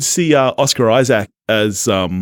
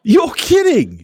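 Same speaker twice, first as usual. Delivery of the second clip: disbelief; 11 semitones higher, slower than usual. Real, not acted. Co-host shocked at ranking.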